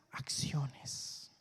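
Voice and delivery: susurra la palabra final; susurro. Acted. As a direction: dilo en un susurro, como un secreto. Clímax de frase, susurro.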